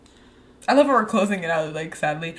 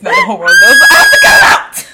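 shouting; 11 dB louder than usual. Acted. Joking outburst about baby voice.

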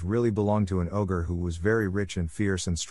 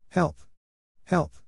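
crying for help; 9 semitones higher than usual. Acted. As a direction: call out for help in panic. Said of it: "he cried".

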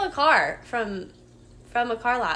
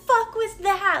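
startled panic; 9 semitones higher than usual. Retold or imitated. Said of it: "I'm like".

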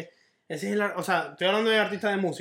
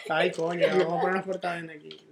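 ordinary talk, nothing marked

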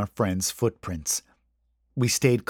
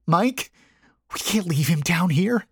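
high, almost desperate plea; desperate whisper; 8 semitones higher than usual. A narrator's reading. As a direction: plead in a high, lightly whispered voice. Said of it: "she whispered".

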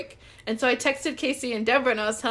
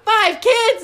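higher, much louder incredulous outburst; shocked disbelief; 12 semitones higher, 12 dB louder than usual. Retold or imitated. Reacting to her crush having five kids, stunned. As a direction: blurt it out in loud disbelief.

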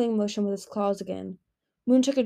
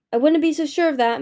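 higher, louder warning to Anemone; 7 semitones higher, 6 dB louder than usual. Acted. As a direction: cut in sharply with a doubt. Moon warns Anemone.

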